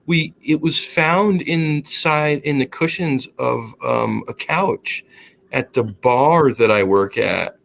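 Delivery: slow, halting take on a woman's voicemail; lightly voiced woman picking words slowly; imitated female caller. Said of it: "I hear this female's voice".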